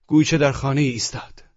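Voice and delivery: usual speaking voice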